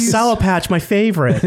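put-on high chirpy kid voice; happy little kid squeal. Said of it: "a little kid voice".

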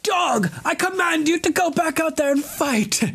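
high shrieking command, far off his own voice; idiot king. Retold or imitated. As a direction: shriek orders like a spoiled boy king. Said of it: "like a fucking idiot".

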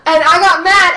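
high shrieking laugh into a loud line. Real, not acted. Retelling her friend's bad joke, shrieking with laughter. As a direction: crack up mid-rant.

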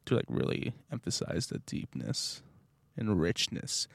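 low, soft, rich voice; dropped deep voice. Shown as a demonstration. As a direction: drop the voice to show off its depth. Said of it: "drop my voice like this".

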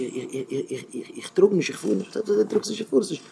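stuttering excuse under the voice; hushed stammer. Acted. Someone questioned about what he carries, faltering and hushed. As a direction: stumble softly over the first word, repeating it. Acting out a stammering, evasive answer.

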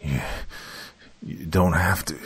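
stammering through pain; halting stutter, in pain. Acted. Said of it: "caused him to stutter".

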